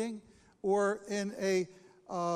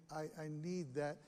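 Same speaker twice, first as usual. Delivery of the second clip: normal talking voice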